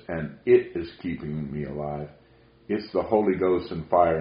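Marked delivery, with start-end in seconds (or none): none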